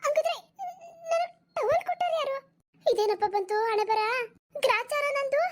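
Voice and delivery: put-on high, near-crying old woman in a fright; a granny whose heart is pounding with shame; panicked old woman. Old woman realises a stranger came. (ಗಾಬರಿಯಿಂದ ಅಳುವಂತಹ ಎತ್ತರದ ಮುದುಕಿಯ ಧ್ವನಿ)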